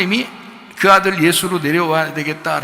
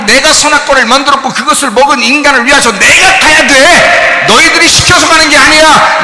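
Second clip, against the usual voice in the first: fiery preaching; 11 semitones higher, 14 dB louder than usual. Real, not acted. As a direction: thunder it from the pulpit. Preaching at full force.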